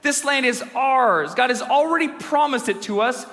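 high, slightly slowed urging; rally the group with faith; rallying plea. Retold or imitated. Preacher voicing Caleb urging the people on, rallying. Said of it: "beautiful heart of faith, and he says".

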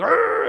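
loud high playful roar; mock monster roar. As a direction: growl a fake roar to scare the kids. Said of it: "I'm like".

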